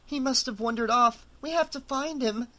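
anxious and rising; rising panic. A narrator's reading. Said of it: "Rachel's voice was rising again".

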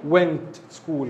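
I went school spelled out very slowly; teacher dictating slowly; slow model sentence. Shown as a demonstration. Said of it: "اقول ايه".

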